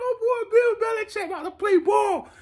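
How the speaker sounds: high, hyped mock Belichick; hammed-up Belichick. Fan doing a mock Belichick after putting the hoodie on, high and hyped. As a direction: puff up like a hyped coach. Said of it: "Let me get my Bill Belichick, uh, impression on".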